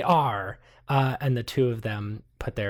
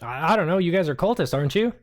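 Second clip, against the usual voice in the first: higher, louder NPC accusation; lightly voiced accusing journalist; mildly accusing; 5 semitones higher, 4 dB louder, faster than usual. Journalist accusing the party of being cultists, higher pitched.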